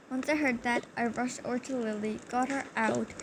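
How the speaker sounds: ordinary delivery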